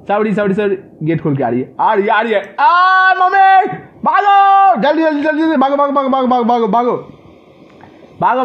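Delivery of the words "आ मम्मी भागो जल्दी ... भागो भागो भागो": loud high shriek, then frantic urging; terrified scream. Real, not acted. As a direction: scream in fright, easing into rapid panicked chanting. Granny jump scare, he flees screaming. (डर से चीख, फिर घबराई पुकार)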